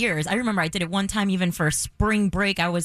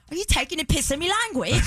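put-on British accent; 8 semitones higher than usual. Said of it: "he literally goes".

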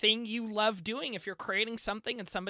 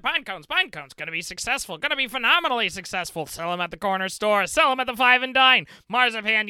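loud hard-sell pitchman voice; old-timey announcer; 4 semitones higher, 9 dB louder than usual. Pretend. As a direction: sell it like a fast-talking huckster. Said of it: "an old-timey announcer voice".

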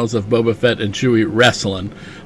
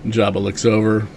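ordinary delivery

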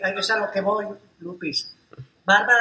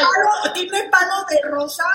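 much higher, louder gushing question; thrilled cohost asking about the rose tint; excited; 10 semitones higher, 5 dB louder than usual. Real, not acted. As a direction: squeal it with delight over the filter. Excited about the light filters.

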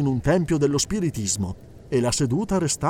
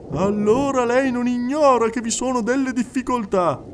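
high, insistent clairvoyant addressing the hall; a medium coaxing a silent widow; medium's voice; 9 semitones higher, 3 dB louder than usual. Acted. Medium speaking to the crowded séance hall, high and insistent.

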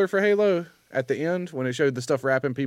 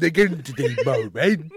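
mock Irish Neeson. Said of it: "sound like he's Irish".

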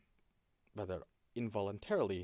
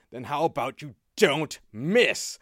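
loud, slow and menacing; menacing threat; 6 semitones higher, 15 dB louder, much slower than usual. Acted. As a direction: drag it out, then spike the okay. Watchman's pointed threat to player.

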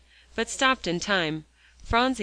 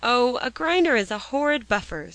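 louder, higher, impatient outburst; exasperated, raised-voice answer; 6 semitones higher, 6 dB louder than usual. A narrator's reading. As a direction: burst out with impatience. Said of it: "began Joel impatiently".

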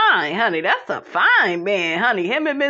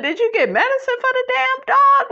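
incredulous; 14 semitones higher, faster than usual. Real, not acted. Mocking Miss Nell's son's excuse.

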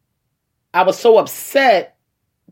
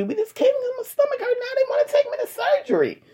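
squeaky disbelief. Retold or imitated. Telling partner, mock disbelief.

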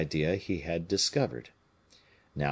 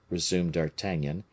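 plain reading voice

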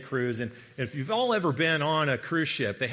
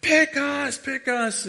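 high, loud re-enacted scream; eager screaming; 12 semitones higher, 6 dB louder, slower than usual. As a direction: yell out to get picked, pitched way up. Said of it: "start screaming".

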